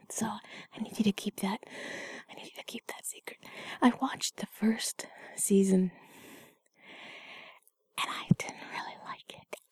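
soft confiding whisper. Acted. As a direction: keep it a hushed secret. Held whisper.

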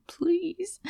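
high, rising breathy plea; begging please. Retold or imitated. Retelling how she begged her lover, high pleading please. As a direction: beg it, lifting up high at the end. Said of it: "and I begged you again".

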